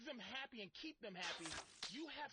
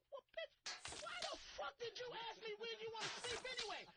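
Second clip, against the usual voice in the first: higher, exasperated retort; mock exasperated outburst; 10 semitones higher than usual. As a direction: act out snapping back at the ex in disbelief. Retelling his angry reply.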